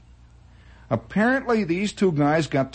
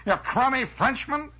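higher, slow jeering taunt; mock insult; 8 semitones higher, slower than usual. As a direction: sneer at the Frenchman. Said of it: "somebody came in and says".

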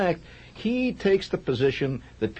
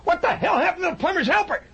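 much higher, fast exasperated yell; angry outburst; 3 dB louder, faster than usual. Retold or imitated. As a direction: blurt it out in sudden anger. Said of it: "a swirl of anger went through my head".

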